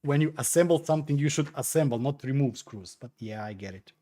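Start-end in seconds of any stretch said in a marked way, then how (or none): none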